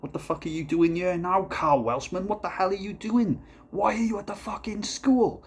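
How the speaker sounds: high-pitched mock shouting policeman; angry cop. Police confronting him at school.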